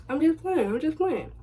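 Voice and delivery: high, quick mock reassurance; hammed-up backpedal; boyfriend backpedaling. Boyfriend taking back the pregnancy joke, high mock plea.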